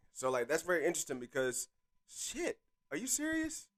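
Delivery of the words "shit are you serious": surprised. Real, not acted. Just clicked off the video.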